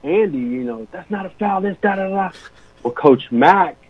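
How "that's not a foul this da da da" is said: higher-pitched mock protest; arguing a call. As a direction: retell yourself going at the referee. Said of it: "I'm coming at".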